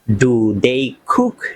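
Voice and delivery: each word drawn out slowly for learners. Shown as a demonstration. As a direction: spell it out slowly like a teacher. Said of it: "আমি খুব আস্তে আস্তে বলছি". (খুব ধীরে)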